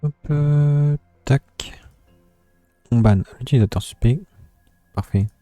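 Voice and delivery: loud excited French streamer; loud French commentary. Real, not acted.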